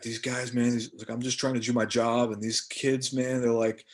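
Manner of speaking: annoyed security guard. Retold or imitated. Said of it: "the guy was like".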